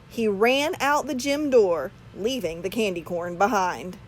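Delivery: usual speaking voice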